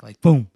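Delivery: a sudden loud boom of a fall. Retold or imitated. Showing how his friend hit the ground, a loud boom. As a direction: slam the word boom down hard. Friend falling flat, impact.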